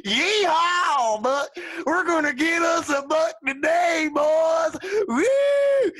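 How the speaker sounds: loud mock yeehaw with a triumphant woo; rowdy hunter whoop.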